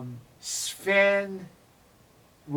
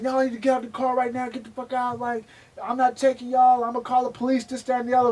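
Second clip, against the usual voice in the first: imitating the driver; 6 semitones higher, faster than usual. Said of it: "so he sounds like".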